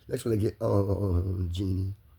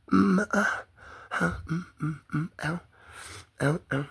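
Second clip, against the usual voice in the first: string of higher-pitched stuttered moans and ows; wordless moaning; 5 semitones higher, slower than usual. Shown as a demonstration. Showing how the bodily sensation feels, repeated moans and ows. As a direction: moan in short repeated bursts, pitch raised, tailing into ows.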